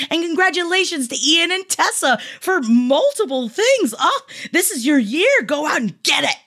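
high, gushing congratulations; excited cheering. Acted. Host congratulates Ian and Tessa, excited cheering. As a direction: cheer them on at a high pitch.

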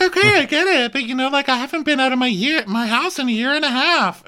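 high mock Brandy voice; Brandy impression. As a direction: squeak it out like a flustered Brandy.

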